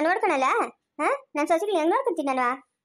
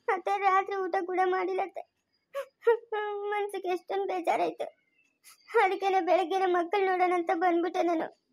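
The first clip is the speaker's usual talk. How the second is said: softly crying while explaining; hurt, quiet voice catching with sobs; tearful; 2 semitones higher, 5 dB quieter than usual. Acted. Upset young woman explains to her aunt why she came, tearful. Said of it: "ಅದಕ್ಕೆ ಅಳ್ತಾ ಇದೀಯಾ".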